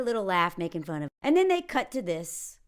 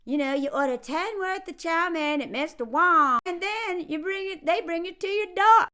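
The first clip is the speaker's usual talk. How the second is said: put-on southern accent; 6 semitones higher than usual. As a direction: make fun in a southern accent. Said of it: "I go into my southern accent".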